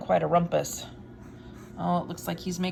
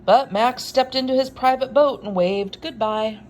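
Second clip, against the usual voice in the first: big dramatic read-aloud, louder on the monsters; story narration; 8 dB louder, slower than usual. A narrator's reading. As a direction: build the wild things up for the kids. Reads picture book aloud.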